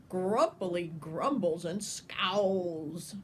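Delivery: ordinary delivery, nothing marked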